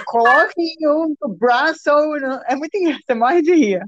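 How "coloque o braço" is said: put-on Portuguese with a heavy American accent. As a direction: imitate an American app voice speaking Portuguese. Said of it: "português com sotaque americano". (português com sotaque americano)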